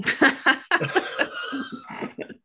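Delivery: breathy high-pitched laugh. Real, not acted. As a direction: laugh airily at the joke. Laughing at baby and milk.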